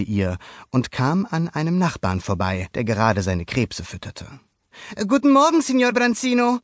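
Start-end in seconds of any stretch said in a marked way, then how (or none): 5.1-6.6 put-on quick, high boyish hello; hasty boy greeting. A narrator's reading. Luca hurries past the neighbor, high quick greeting. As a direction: rush the greeting as young Luca. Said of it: "sagte Luca hastig".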